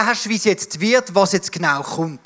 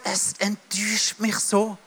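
softer, slow, disappointed sigh of a line; hurt and disappointed; 7 dB quieter, slower than usual. Retold or imitated. Little boy telling himself how let down he is, soft and slow. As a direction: let the hurt sink in quietly.

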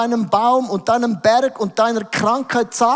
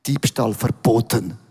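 lightly voiced stern German, low; blunt German; 9 semitones lower than usual. Preacher mocking a blunt German sign, low and firm. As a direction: state it flat like a German rule. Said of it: "In Deutschland heißt".

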